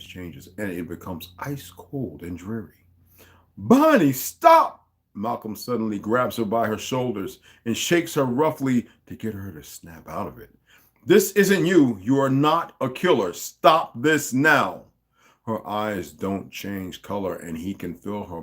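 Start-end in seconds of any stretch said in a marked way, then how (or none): 3.6-4.9 high loud cry of "stop"; shouting. Acted. Malcolm yelling at Bonnie to stop her rage, shouting. 11.1-14.8 loud, higher, slowing to "Stop this now"; firm desperate command; urgent plea. Acted. Malcolm urging Bonnie to stop killing, loud plea.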